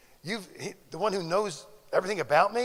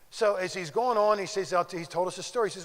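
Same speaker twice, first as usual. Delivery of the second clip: ordinary talk, nothing marked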